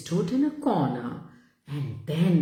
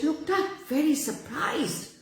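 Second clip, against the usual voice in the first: ordinary delivery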